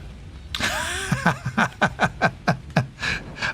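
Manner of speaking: high cracking laugh; burst of laughter. Real, not acted. Caught out by friend's question.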